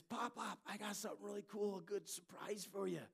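lightly voiced eager little boy, high and quick; a kid bursting to share a surprise; excited grandson. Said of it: "he says".

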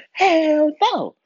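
high, loud indignant refusal; wife's outraged no. Retold or imitated. Wife refusing the vaccine, high and loud. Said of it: "She was like".

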